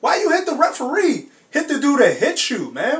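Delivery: ordinary talk, nothing marked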